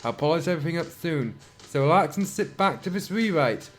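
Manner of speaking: ordinary delivery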